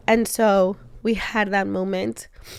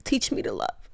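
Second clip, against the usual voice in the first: whispered prayer; 2 semitones higher, 5 dB quieter than usual. Real, not acted. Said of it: "my one prayer always is".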